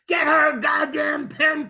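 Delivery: high mock yell; retold scream. Screaming to the nurse for mother's pen, retold scream. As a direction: yell it in put-on desperation. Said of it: "I scream to the nurse".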